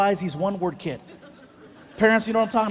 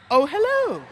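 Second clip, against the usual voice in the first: higher, bright mock-eager hello; sudden eager greeting; 7 semitones higher than usual. Son hearing about money, perking up eagerly. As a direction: perk up when cash appears.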